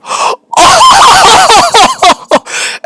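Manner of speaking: very high, loud hysterical laugh; wild disbelieving laughter; shrieking laugh. Real, not acted. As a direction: cackle in shock.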